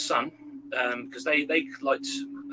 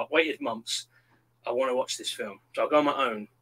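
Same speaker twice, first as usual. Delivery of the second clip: ordinary delivery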